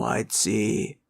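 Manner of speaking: ordinary talk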